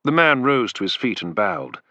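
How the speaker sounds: plain reading voice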